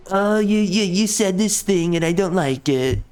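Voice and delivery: put-on whiny commenter voice, pitched slightly up; mocking a complainer. YouTuber imitating a viewer who dislikes what he said, mocking. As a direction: mimic a petty viewer with contempt.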